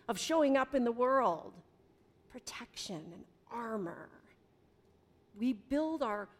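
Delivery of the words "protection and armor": whisper. Acted. Sermon aside, whispered key words.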